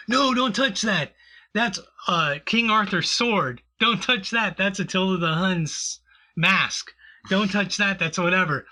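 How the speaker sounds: high-pitched, protective mock warning; fussy collector impression. Collector warns visitor off his artifacts, mock alarm. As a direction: play a collector fretting over his artifacts.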